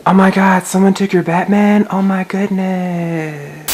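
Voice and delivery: loud high mock gasp; fake shock. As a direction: ham up the surprise. Faking shock.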